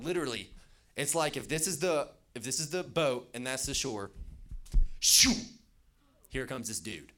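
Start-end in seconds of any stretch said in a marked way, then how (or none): 5.0-5.3 loud, sudden "shoo" whoosh; explosive vocal whoosh; whooshing sound effect. Retold or imitated. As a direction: burst out a whoosh like something zooming in. Imitates sudden rush from shore.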